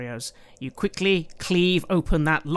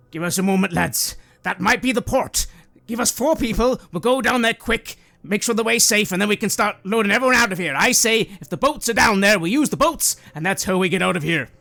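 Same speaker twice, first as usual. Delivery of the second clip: put-on raised, higher, forceful older man's voice; older guy; 6 semitones higher, 6 dB louder than usual. Older guy to the lads about the escape, urgent. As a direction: build into loud, urgent orders. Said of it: "the older guy".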